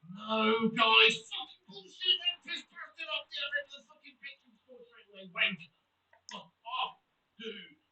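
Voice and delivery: loud furious swearing; angry rant. Real, not acted. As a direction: rage at the game. Conceding goal, raging.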